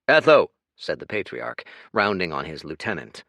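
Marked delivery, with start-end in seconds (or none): 0.1-0.5 loud sharp name call; sharp warning. A narrator's reading. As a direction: snap his name as a warning. Patriarch rounds on him.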